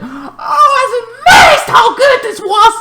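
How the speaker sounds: very high, loud shrieking praise; overexcited high-pitched yell; amazed shout. Real, not acted. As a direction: scream out your amazement. Gushing over episode.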